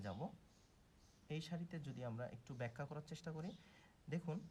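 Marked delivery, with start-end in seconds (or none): none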